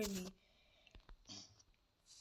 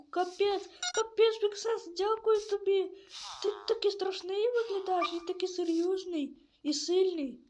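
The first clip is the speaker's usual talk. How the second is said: higher, excited thanks to the hero; grateful villager; 6 semitones higher than usual. Acted. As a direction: raise the voice and gush admiring thanks. (вдячний житель високим голосом захоплено дякує героєві)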